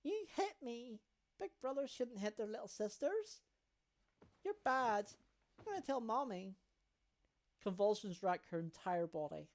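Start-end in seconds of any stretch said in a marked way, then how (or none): 0.0-6.6 put-on high child voice scolding big brother; a small sister threatening to tell Mommy; little girl tattling.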